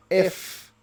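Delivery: normal talking voice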